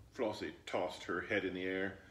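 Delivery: ordinary delivery, nothing marked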